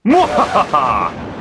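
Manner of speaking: slow villainous cackle; evil laugh. Acted. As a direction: cackle like a mad scientist. Halloween series evil laugh intro.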